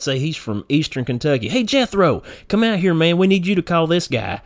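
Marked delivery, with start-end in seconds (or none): 1.5-4.4 high-pitched country holler to a coworker; calling coworker over. Acted. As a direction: summon a fellow good old boy. Acted boss calling a coworker over.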